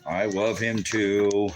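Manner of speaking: loud, slow doting croon; gushing affection. Real, not acted. Saying he loves his dog too, gushing affection. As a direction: draw it out, loud and adoring.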